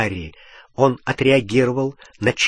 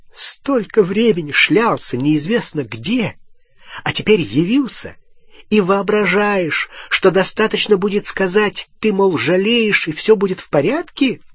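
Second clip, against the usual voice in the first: high, scornful, climbing harangue; indignant tirade; 7 semitones higher, 3 dB louder than usual. Acted. Hermione scolding Ron for turning up sorry, indignant tirade. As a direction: rail at him, mocking his excuse. Гермиона отчитывает вернувшегося Рона.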